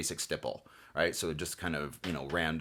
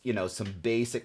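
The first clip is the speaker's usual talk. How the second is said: normal talking voice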